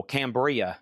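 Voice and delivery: Cambria with the weight on CAM; stress on first syllable. Shown as a demonstration. Auctioneer showing buyers how to say Cambria, stress on CAM. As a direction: land hard on CAM in Cambria. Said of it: "accent on the first syllable".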